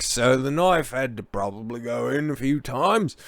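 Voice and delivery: put-on slow, slurred English drunk; a drunk Englishman rambling; drunk English accent. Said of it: "grab bag of English accents".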